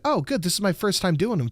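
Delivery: lightly voiced doctor, pitched high; mock-relieved, high and chirpy; joking doctor. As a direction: tease the patient with false relief. Acting out the doctor's teasing joke.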